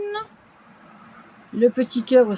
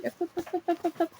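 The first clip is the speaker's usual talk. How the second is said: playful sing-song hops; 9 semitones higher, 8 dB quieter, much faster than usual. Real, not acted. Playful filler while handling.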